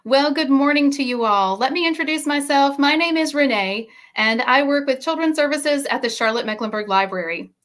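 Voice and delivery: usual speaking voice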